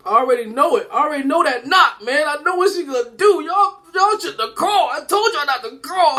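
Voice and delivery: high, loudening panic at impending doom; hammed-up frightened kid; panicked. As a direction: wail in dread, pitch climbing. Child panics at mother's knock.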